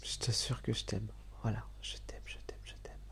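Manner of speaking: tender whisper, fading away; whispered love. Real, not acted. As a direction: breathe the words close to her ear. Déclaration d'amour chuchotée. (je t'aime chuchoté, de plus en plus bas)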